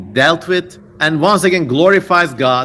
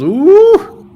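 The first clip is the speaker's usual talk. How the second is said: loud high-pitched delighted ooh; excited ooh; 10 dB louder than usual. Real, not acted. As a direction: squeal it out, much higher and louder. Reacting to seven hot girls.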